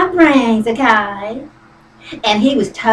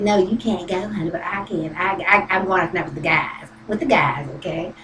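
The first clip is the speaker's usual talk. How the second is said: lower, softer, offhand husband voice; a hint of the husband; 6 semitones lower, 10 dB quieter than usual. Retelling how her husband refused to take her, low and much softer. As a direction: lightly play the man brushing her off.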